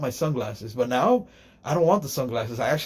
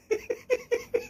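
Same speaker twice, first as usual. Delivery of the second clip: high squeal of a laugh; 5 dB quieter than usual. Real, not acted.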